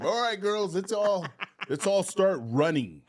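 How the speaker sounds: loud, high mock rallying call; coach calling out. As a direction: imitate a coach hurrying the team along, loudly.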